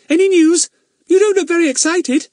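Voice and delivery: put-on little girl Bets. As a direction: call out hopefully to Fatty. Bets voiced, girlish.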